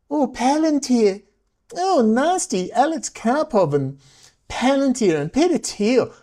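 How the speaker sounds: high-pitched mocking "ooh"; sing-song scandalised gossip, hammed up. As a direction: stretch the names in high mock horror. Mocking others' fuss over personalities.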